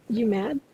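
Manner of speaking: quick offhand "You mad?" with a light questioning lift; casual question. Shown as a demonstration. Teacher showing students a casual question tune. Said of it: "I just said that in four different ways".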